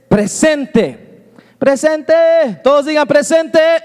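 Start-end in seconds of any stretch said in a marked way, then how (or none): 1.6-3.8 higher, raised rallying call. Real, not acted. Preacher asks everyone to say presente, calling out. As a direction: get the whole room to shout it back.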